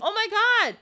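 high-pitched acted gasp of astonishment; stunned king, pitch leaping up; shocked recognition. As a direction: cry it out in mock shock. King recognizes his ragged daughter.

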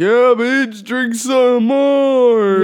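high, loud, stretched-out taunt; sneering high drawl of a drunk killer; mock killer voice. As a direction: egg the victim on, hammed-up. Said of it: "And he's like".

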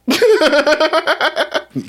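big high-pitched laugh; real laugh at the story, falling off. Real, not acted.

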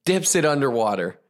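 ordinary delivery

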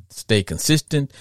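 normal talking voice

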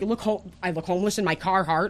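ordinary talk, nothing marked

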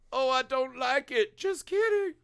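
very high, put-on voice; playful mock falsetto quote; mock teasing voice. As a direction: squeak it out as a jokey imitation. Mock quote of a wavering verdict.

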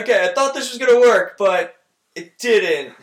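loud, high comic protest, slowing at the end; mock sheepish hero. Fan imagining the actor's line after a failed stunt, mock dismay. Imitating Paul Rudd's reaction.

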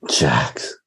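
unvoiced low whisper of the name; whispered answer. Real, not acted. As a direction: breathe the answer like a secret. Whispered answer, who murdered more.

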